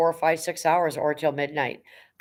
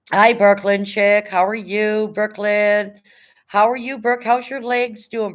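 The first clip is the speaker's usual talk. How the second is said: excited greeting; 5 semitones higher, 6 dB louder, slower than usual. Real, not acted. Greeting a friend arriving on stream.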